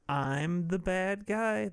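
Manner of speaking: a light Michael Douglas voice, slow; Michael Douglas impression. Zolo's villain line, lightly voiced Michael Douglas. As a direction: declare it slowly as a mock Michael Douglas. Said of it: "That's what Michael Douglas sounds like".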